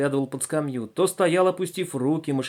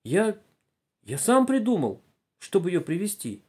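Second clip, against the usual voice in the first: put-on high, hesitant boyish confession; timid babbling; 6 semitones higher than usual. A narrator's reading. Borya admits his idea to the passengers, babbling timidly. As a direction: confess haltingly, stumbling on the start. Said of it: "пролепетал Боря".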